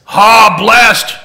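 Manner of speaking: loud exclamation. Real, not acted. Teacher echoing the big people's answer, exclaiming "blessed" loudly.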